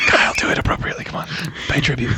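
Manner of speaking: whispered urging. Real, not acted. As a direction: hush it out. Whispering during ASMR joke.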